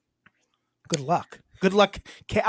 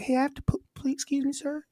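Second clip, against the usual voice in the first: meek polite asker; 8 semitones higher, 6 dB quieter than usual. Pretend. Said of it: "maybe if I was more like".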